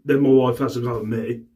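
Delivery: normal talking voice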